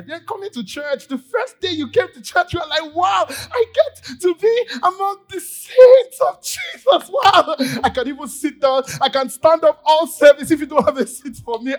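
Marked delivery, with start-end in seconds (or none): 2.9-11.8 ecstatic shout. Acted. New believer first day at church, ecstatic shout. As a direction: burst with joy at just being there.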